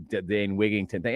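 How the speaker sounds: normal talking voice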